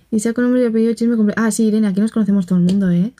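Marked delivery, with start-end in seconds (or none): 1.5-3.1 fast, knowing gossip; quick chatty tease; rapid aside. Real, not acted. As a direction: rattle it off quickly. Reading chat gossip claim.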